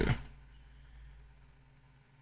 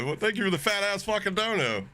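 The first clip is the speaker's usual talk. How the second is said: ordinary talk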